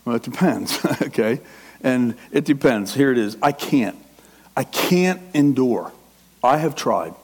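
ordinary delivery

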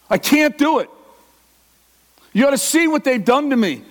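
usual speaking voice

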